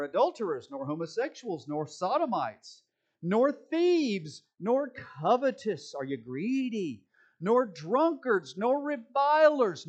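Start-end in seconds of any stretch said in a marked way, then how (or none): 3.2-9.9 rising preaching emphasis. Real, not acted. Preacher rising through vice list.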